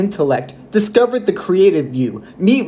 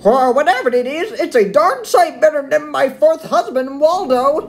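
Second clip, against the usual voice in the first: put-on old lady voice; 11 semitones higher than usual. Old lady potter talks of husband.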